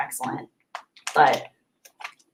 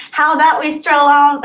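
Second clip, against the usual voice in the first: higher Southern twang, a rough first attempt; Southern accent; 8 semitones higher, 7 dB louder than usual. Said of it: "I'm not great at the Southern accent".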